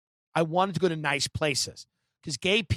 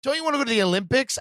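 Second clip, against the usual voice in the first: eager friend; 7 semitones higher, much faster than usual. Retold or imitated. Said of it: "I had a friend go".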